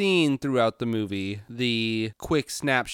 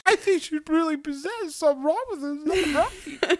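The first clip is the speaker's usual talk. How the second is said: high, squeaky mock concern; mock onlooker. Onlooker remarking on possessed friend, high mock worry. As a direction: pretend alarm in a high voice.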